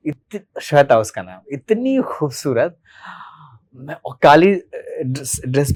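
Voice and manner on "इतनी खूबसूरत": इतनी stretched slowly, pitched up; awestruck admiration. Real, not acted. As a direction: linger on इतनी as if still smitten. Gushing over first sight of girl. (इतनी को धीरे से खींचकर, मुग्ध भाव से)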